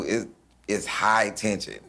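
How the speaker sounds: ordinary talk, nothing marked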